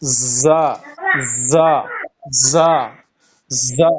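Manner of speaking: زا with teeth closed and a whistling z; teeth-together whistle on زا; whistled za. Shown as a demonstration. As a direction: repeat زا, hissing through the teeth. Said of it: "سیٹی کی آواز بھی ساتھ ساتھ نکالے".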